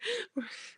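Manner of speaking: brief high, soft laugh; quick quiet giggle. Real, not acted. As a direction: let out a small high-pitched laugh. Laughs between chat remarks.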